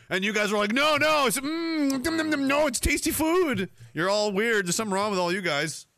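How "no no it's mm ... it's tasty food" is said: higher, hammed-up munching protest; mock lobster fan. Viewers defending lobster, mildly hammed-up munching voice. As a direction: defend the food like a smug glutton.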